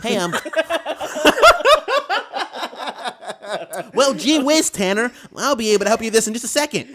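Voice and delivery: high, loud, fast and laughing hokey customer-service guy. Retold or imitated.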